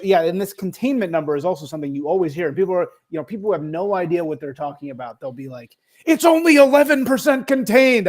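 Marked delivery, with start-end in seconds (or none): 6.0-8.1 high, loud imitation of alarm; loud, high-pitched mock outrage; mock outraged complainer. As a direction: parody someone clueless making a fuss. Mocking clueless complainers.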